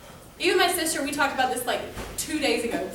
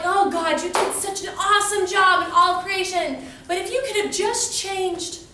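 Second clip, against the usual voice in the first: higher, louder gushing prayer; mock praise; 6 semitones higher, 4 dB louder than usual. Believer addressing God about creation, mock gushing. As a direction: pretend to flatter before a complaint.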